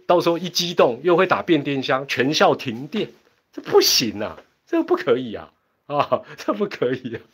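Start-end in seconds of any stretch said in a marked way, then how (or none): none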